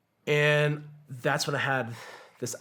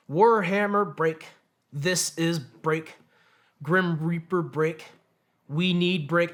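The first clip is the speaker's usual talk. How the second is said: halting radio call, slow and a bit louder; dazed radio call; 5 semitones higher, 5 dB louder, much slower than usual. Retold or imitated. Stunned soldier radioing for support, halting and dazed. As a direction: stumble through a call sign in a daze. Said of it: "the worst radio transmissions ever".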